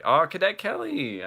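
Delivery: high, loud burst of remembering; excited, soaring exclamation; delighted recognition. Real, not acted. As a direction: exclaim it as it comes back to you. Suddenly remembers the forgotten movie.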